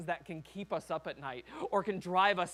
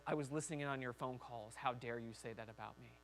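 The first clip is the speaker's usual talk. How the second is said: low, quiet, lightly voiced stern parent; understated parental reproach; harsh parent; 6 semitones lower, 8 dB quieter than usual. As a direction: drop your voice into a restrained scolding. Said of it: "not that harsh parent who says".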